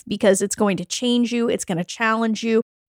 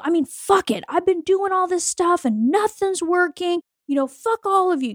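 higher, fed-up imitation of her past self; higher hammed-up bitter self-talk; mock bitter frustration; 8 semitones higher than usual. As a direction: throw it away like you're done with everyone. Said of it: "I remember thinking that same exact shit".

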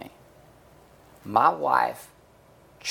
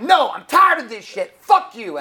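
furious shouting; 12 semitones higher, 10 dB louder than usual. Retold or imitated. Wife yelling at husband mid-fight, high furious shout. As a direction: imitate wife's loud, high angry yell.

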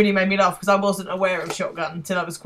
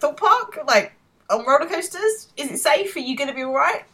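much higher, rising anxious questions; fretting mum impression; 9 semitones higher, 3 dB louder than usual. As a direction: play an over-worried mum, voice pitched up. Voicing Will's anxious mum.

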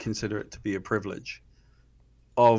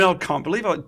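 ordinary talk, nothing marked

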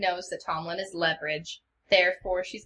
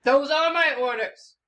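louder, higher firm order; commanding; 6 semitones higher, 6 dB louder than usual. Acted. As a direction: lay down the law as lord. Lord asserting command.

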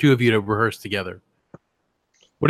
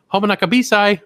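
higher, sing-song phone greeting; commercial phone greeting; 8 semitones higher, 4 dB louder than usual. Retold or imitated. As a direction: answer the phone like the ad. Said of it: "he picks it up and he goes".

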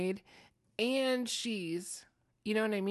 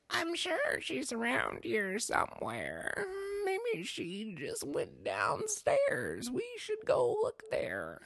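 slow, creepy witch voice; very disturbing voice; 6 semitones higher, slower than usual. Acted. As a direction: draw it out eerily, like a sinister witch. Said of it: "in a very disturbing voice".

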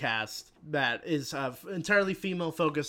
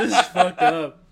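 louder, laughing through the words; imitating brother, laughing; 3 semitones higher, 13 dB louder than usual. Retelling, he imitates his brother laughing at the movie. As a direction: say it while cracking up. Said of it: "My brother's like".

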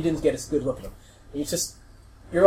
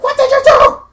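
very high, loud screech; acted shrieking; 14 dB louder than usual. Gretchen confronts the orc, high screeched accusation. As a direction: screech the question at full volume. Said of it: "You hear something".

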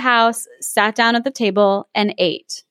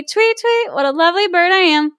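much higher, twittering bird voice; chirpy bird; 6 semitones higher than usual. Acted. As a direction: chirp it like a proud little bird. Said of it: "After ending a song".

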